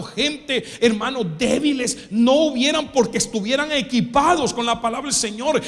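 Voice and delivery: rising preacher's cadence; preaching. Acted. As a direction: build the sermon toward a climax. Preacher building up. (predicando con fuerza creciente)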